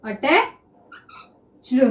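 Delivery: drawn out very slowly while writing; attached said very slowly, as in dictation. Shown as a demonstration. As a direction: stretch the word attached as you write it. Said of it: "देखिए".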